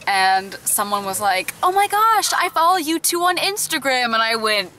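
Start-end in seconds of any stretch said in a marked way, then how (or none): 1.6-4.1 high-pitched mock fangirl gush; retold squeal of recognition, pitched way up; imitated excited fan. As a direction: gush like a thrilled fan spotting someone. Imitates excited fan greeting them.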